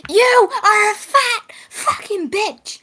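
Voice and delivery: mock screaming. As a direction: yell the insult in play-fighting fury. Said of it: "you'll be screaming".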